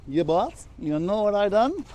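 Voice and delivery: high, drawn-out mock Jamaican accent.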